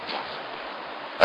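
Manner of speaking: slow, breathless whisper; whispered resolve. Acted. Nehemiah refuses to leave his work, a slow whisper. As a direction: drop to a hushed vow.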